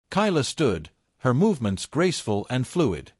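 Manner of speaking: usual speaking voice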